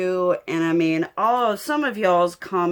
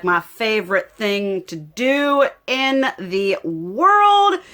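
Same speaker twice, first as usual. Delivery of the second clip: ordinary talk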